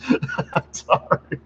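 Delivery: breathless laugh through the words; can't stop laughing as you apologize; giggling real laughter; laughing apology. Real, not acted. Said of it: "I should not be laughing this much".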